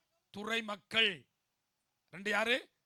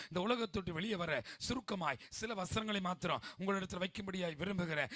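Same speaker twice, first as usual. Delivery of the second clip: ordinary delivery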